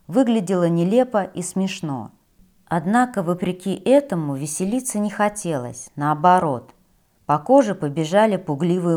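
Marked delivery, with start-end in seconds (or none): none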